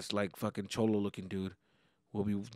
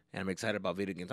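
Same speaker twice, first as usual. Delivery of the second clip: ordinary talk, nothing marked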